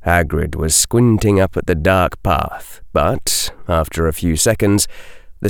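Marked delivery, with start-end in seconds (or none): none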